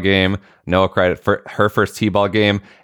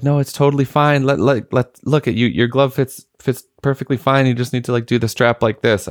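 put-on higher, gentle reassuring voice to a little girl; soothing a child; 7 semitones higher than usual. Dad soothing crying daughter.

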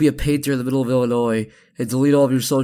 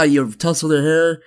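normal talking voice

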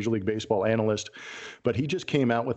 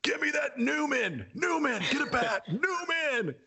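mock scream; 14 semitones higher than usual. Manager screaming down the bench for a player, high mock scream. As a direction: imitate a manager hollering down the bench. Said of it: "starts screaming down the end".

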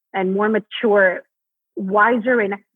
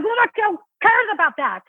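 much higher, full-throated scornful "Who the hell cares about that?", committed; dismissive for real; 10 semitones higher than usual. Shown as a demonstration. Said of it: "just deliver".

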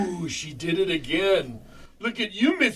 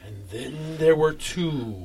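ominous narrator; slower than usual. Pretend. Ominous murder mystery line.

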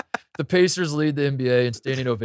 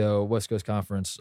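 ordinary talk

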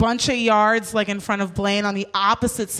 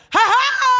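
high, loud mock taunting "ha ha"; gloating laugh; 13 semitones higher, 5 dB louder than usual. Rider gloating as the cab skips her friend, loud mock laugh. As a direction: crow it out, triumphant and much higher. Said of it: "the other one was like".

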